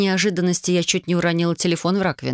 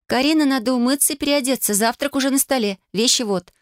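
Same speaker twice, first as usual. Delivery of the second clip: put-on higher, beaming young woman; cheerful girl voice; 8 semitones higher than usual. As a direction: smile through the words. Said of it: "улыбаясь во все свои тридцать два зуба".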